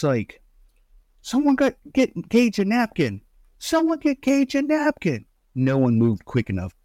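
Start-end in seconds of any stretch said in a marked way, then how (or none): 1.2-5.3 high-pitched, frantic imitation of a call; urgent mock calling. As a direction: call for help in a hammed-up panic, voice way up. Imitates Adam urgently calling for help.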